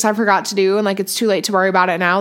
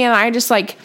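ordinary talk

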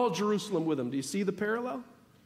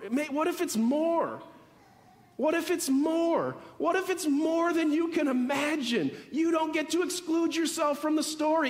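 higher, fuller preaching voice building momentum; worked-up preaching; 5 semitones higher than usual. Real, not acted. Pastor to congregation about grace, worked-up preaching. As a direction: preach it with rising fervour, leaning into the big words. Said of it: "And now I'm getting worked up".

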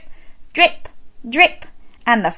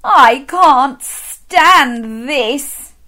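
very slow, louder, drawn-out exasperated groan; fed-up bear; 4 semitones higher, 5 dB louder, much slower than usual. Acted. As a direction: stretch every word like a worn-out bear. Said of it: "said Mister Bear".

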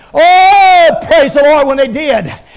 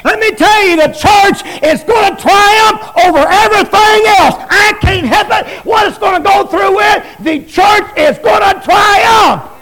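fiery preaching; 7 semitones higher than usual. Acted. Preacher shouting triumph to the congregation, full force. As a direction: preach it to the rafters.

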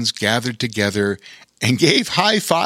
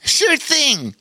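much higher, eager agreement; enthusiastic. Acted. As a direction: jump at the offer. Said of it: "he said with enthusiasm".

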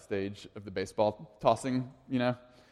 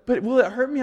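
put-on worried kid; 12 semitones higher, 8 dB louder than usual. Son voiced in story.

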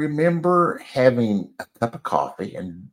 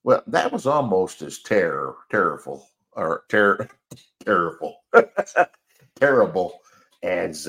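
usual speaking voice

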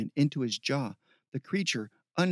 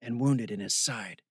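low, slightly rough delivery; lightly roughened, lower voice; 4 semitones lower than usual. A narrator's reading. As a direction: report the wound in a low rough voice. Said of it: "a rough voice said".

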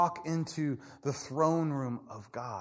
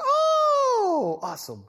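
a high, loud squeal on oh; delighted God; 5 dB louder, much slower than usual. Pretend. God delighted by the Spirit's news, high surprised squeal.

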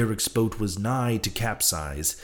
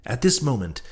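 ordinary delivery, nothing marked

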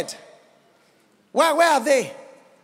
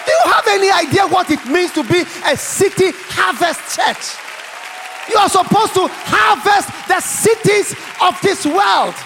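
loud, high preacher's proclamation; full-force preaching; 6 semitones higher, 5 dB louder than usual. Real, not acted. Preacher charging the church to harvest cities, full-force preaching. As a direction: proclaim it to a big hall, ringing out.

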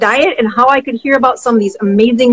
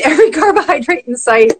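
ordinary delivery

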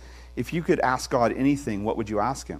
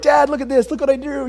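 put-on much higher eager child's voice; excited little kid; 12 semitones higher, 5 dB louder than usual. The pastor voicing his small child begging for attention, high kid voice. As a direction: chirp it up like a small child showing off. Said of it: "they're like".

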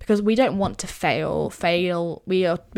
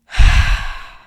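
loud heavy sigh out; big sigh; 10 dB louder than usual. Shown as a demonstration. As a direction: let out a full loud sigh. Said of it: "And another one".

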